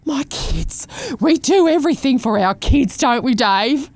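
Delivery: a lightly hammed-up mum turning to her husband; mock gushing mum. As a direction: gush about the kids in a put-on voice. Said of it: "And he's a bit like".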